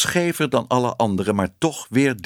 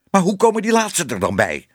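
higher, heated questioning; agitated demand; 8 semitones higher, 3 dB louder than usual. A narrator's reading. Exposed man interrogates the projector boy, agitated and high. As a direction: press him angrily for an answer.

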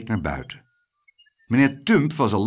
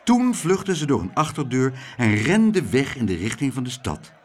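ordinary delivery

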